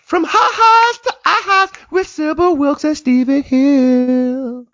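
slow, high, booming show-title read; grand announcer voice, second take; announcer intro. Shown as a demonstration. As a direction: announce the show name like a radio host. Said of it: "Take two".

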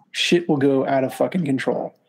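ordinary delivery

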